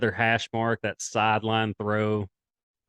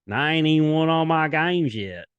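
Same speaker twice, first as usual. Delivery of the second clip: put-on Southern accent; 6 semitones higher, 4 dB louder than usual. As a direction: lay on a heavy Southern accent, pitched up. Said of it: "in his Southern accent".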